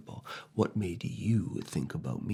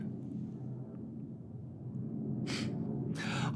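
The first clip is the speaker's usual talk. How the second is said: breathy soft laugh; 6 dB quieter than usual. Acted. Laugh between words.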